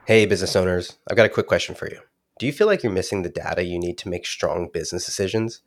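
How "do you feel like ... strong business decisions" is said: usual speaking voice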